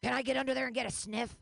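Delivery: lightly voiced leering catcaller. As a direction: play a man hollering at a woman. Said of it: "A gentleman said".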